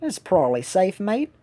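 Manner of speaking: amateur attempt at an Australian accent. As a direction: have a go at Australian, rising at the end. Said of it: "That's my best Australian accent".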